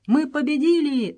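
high, loud cry of victory; triumphant shout. A narrator's reading. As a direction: shout it out, jubilant. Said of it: "закричал он". (торжествующий крик)

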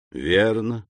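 ordinary delivery, nothing marked

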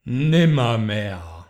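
low, slow, hollow croak of a bird; raven's answer. Acted. The raven answers the pleading man, slow and low.

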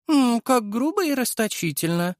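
high, haughty snort of disdain; scoffing. A narrator's reading. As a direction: scoff at the crude idea. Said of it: "фыркнула Мария".

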